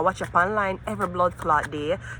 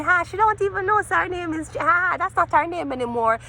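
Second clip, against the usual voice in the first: much higher, laughing through it; mocking laugh; 11 semitones higher than usual. Real, not acted. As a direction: gloat with a high laugh.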